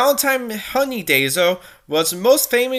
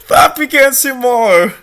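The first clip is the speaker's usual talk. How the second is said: still laughing; 7 dB louder than usual. Real, not acted. Reading while still laughing.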